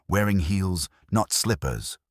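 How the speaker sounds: usual speaking voice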